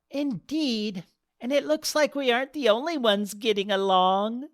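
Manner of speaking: put-on an old guest chuckling at the couple; teasing old lady. Tall crasher teases.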